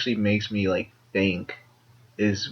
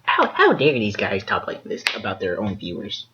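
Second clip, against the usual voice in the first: high, nasal-free prissy critic voice; mock a snooty commenter; snooty viewer; 3 semitones higher than usual. Mocking an offended viewer.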